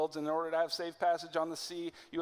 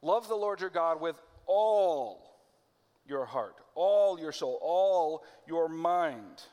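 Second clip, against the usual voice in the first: "all" hit louder and drawn slow each time; stress on all; 5 dB louder, slower than usual. Shown as a demonstration. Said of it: "he's emphasizing the word all".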